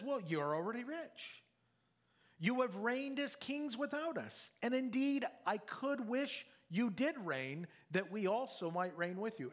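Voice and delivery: mocking praise, pitched high and bright with irony; sarcastic. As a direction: lay the irony on thick, as if praising the proud. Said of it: "you see it's sarcastic".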